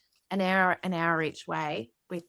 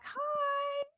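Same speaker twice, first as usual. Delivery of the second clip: very high, soft, sustained greeting; friendly dog voice; 6 dB quieter than usual. Acted. Dog greeting a screaming German Shepherd, high friendly voice. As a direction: sing out a tiny high hello as a dog.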